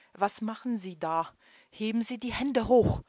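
loud commanding shout; police shout. A narrator's reading. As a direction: bark the order like a cop. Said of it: "rief einer der Beamten".

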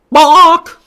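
one loud, high "bawk"; chicken squawk. Acted. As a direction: squawk like an indignant hen. Animals answer Wilford in turn.

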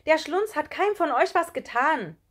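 loud, high, indignant protest; high, emphatic schoolgirl outcry; calling out. A narrator's reading. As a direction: defend a friend at full voice. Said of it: "rief Elli plötzlich".

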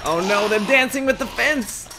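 loud, high cries of alarm; panicked outcry. Real, not acted. Player watching zombies shake the fence, panicked outcry.

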